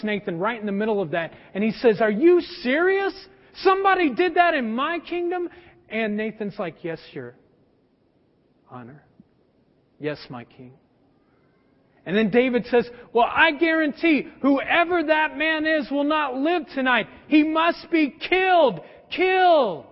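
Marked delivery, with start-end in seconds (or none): 2.0-5.5 a king appalled that this happened in his realm; outraged disbelief. Retold or imitated. 6.8-10.7 much softer and lower, almost fading; hushed reply. Retold or imitated. Prophet Nathan answers the king: low, hushed and softer. 13.1-19.9 a furious king passing sentence; angry verdict. Retold or imitated. King demands death sentence.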